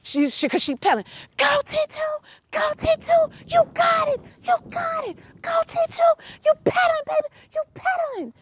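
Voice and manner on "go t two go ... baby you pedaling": much higher, excited cheering; screaming cheer. Retold or imitated. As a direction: cheer her on as she rides away. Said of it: "And I'm screaming".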